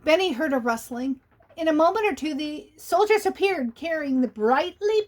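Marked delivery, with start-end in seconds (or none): none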